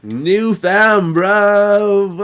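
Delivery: loud, high mock British holler; hammed-up fake British yell; put-on British slang accent. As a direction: holler it in a bad UK accent, drawing out the last word. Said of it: "I have not gotten the accent".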